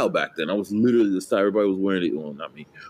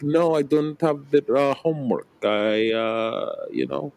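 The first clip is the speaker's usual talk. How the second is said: higher, halting mock Kazakh accent; Borat accent; 7 semitones higher, slower than usual. Pupil winding up teachers over homework in a mock Kazakh accent. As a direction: excuse yourself in a high, hammy Kazakh accent. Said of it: "I used to do it in class".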